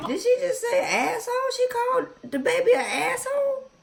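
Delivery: can't believe what she just heard; shocked disbelief. Real, not acted. Cohost reacting to the ex calling the baby names, shocked.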